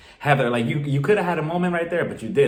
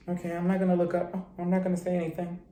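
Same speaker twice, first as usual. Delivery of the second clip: much softer, mumbled under the breath; sheepish mumble; 2 semitones higher, 7 dB quieter, faster than usual. Retold or imitated. Heather and Whitney, sitting like lost puppies, mumble softly. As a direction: mumble like you hope nobody notices you.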